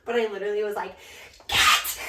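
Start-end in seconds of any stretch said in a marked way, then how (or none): 1.5-2.0 loud yelled "Get out!" with the breath only. Retold or imitated. Bride retelling how she yelled at her sisters, a loud breathy yell. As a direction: blast it at the door. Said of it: "I screamed at my sisters".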